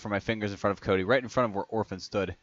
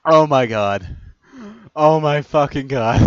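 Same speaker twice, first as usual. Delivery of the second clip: loud exclamation, still cracking up; worn out from laughing; 7 semitones higher, 13 dB louder, slower than usual. Real, not acted. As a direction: drag it out loudly between laughs. Recovering from a laughing fit.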